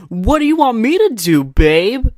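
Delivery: very high, loud, overblown whine; shrieky, hammed-up protest; mock bad commercial acting. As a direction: overact it like a terrible ad actor. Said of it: "I can't even act that bad on purpose".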